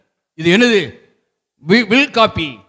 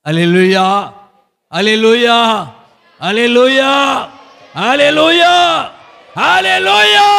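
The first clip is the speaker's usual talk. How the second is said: escalating hallelujah shout; much slower than usual. Real, not acted. Repeated praise call, escalating.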